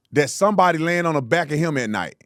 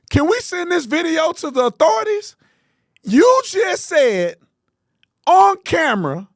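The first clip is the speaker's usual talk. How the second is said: incredulous outrage; 8 semitones higher than usual. Real, not acted. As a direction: sound shocked that he said it on camera. Outraged at clip's call to violence.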